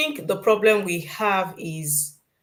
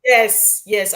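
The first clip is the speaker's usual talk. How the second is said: ordinary talk, nothing marked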